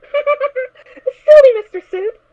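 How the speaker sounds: slow, high giggly words; laughing at the suit; giggling. Acted. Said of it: "Puppy Smiles giggled".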